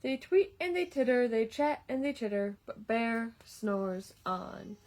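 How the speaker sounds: lively storybook read-aloud; reading to children. A narrator's reading. Reading a picture book to children, lively storytime voice. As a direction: read it like story time for little kids.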